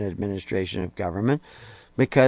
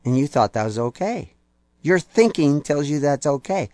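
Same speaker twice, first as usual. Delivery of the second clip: ordinary talk, nothing marked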